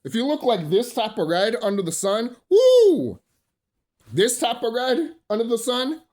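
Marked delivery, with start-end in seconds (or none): 2.5-3.2 high, loud mocking "woo"; loud whoop. Real, not acted. Mocking how red sunburned people get.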